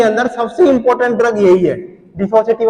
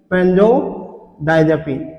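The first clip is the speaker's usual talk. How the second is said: "benzodiazepine" very slow and softer, split by a pause; dictation pace; 6 semitones lower, 6 dB quieter, much slower than usual. Real, not acted. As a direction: break "benzodiazepine" into two slow, low parts for note-takers. Teacher repeats term for dictation. ("benzodiazepine" धीरे-धीरे, तोड़कर लिखवाते हुए)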